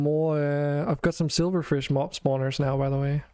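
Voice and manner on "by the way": ordinary delivery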